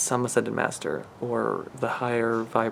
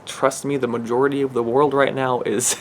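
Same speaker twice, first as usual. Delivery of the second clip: ordinary delivery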